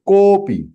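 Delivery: kopi with the "ko" held two beats longer; two-beat extension. Shown as a demonstration. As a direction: stretch the o of kopi, then drop on "pi". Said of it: "Two times".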